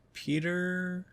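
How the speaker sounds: soft, high questioning call; wondering. Retold or imitated. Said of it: "M J's wondering".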